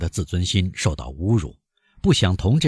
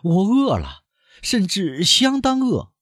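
raised voice; 14 semitones higher, slower than usual. A narrator's reading. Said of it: "他提高声音补充说".

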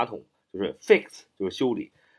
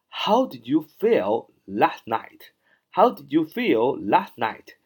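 慢速示范朗读; slower than usual. Shown as a demonstration. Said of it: "你昨晚感觉怎么样？".

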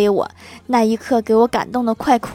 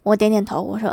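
ordinary delivery

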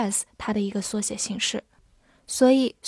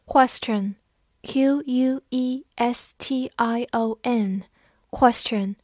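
very slow, letter by letter; slow spelling; much slower than usual. Shown as a demonstration. Teacher spelling the word slowly for students.

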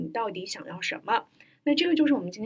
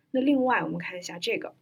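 normal talking voice

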